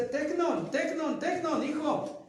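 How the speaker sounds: high, loud calling of a mother; mother searching for her boy, calling loud and high; mother calling her son. Retold or imitated. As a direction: call out for your lost child. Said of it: "Tecnon es la voz de una madre".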